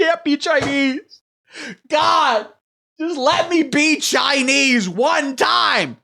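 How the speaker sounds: high, slow hammed-up lament; mock anguish. Streamer begging to do the accent, hammed-up wail. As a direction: plead to God, high and drawn out. Whining he can't be Chinese.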